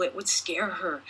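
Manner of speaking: ordinary delivery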